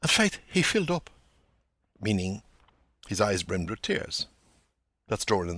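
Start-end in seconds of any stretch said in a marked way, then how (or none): 0.0-1.1 high, lilting Dublin phrasing; Dublin speech. Acted. McCoy telling Bloom about Doran, a light Dublin lilt. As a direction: tell it like a Dublin gossip. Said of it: "flash of direct speech".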